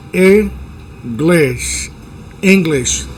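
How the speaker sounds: "English" with a short clipped Spanish I, said slowly; short I demo. Shown as a demonstration. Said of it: "la I del español cortito". ("English" con I corta)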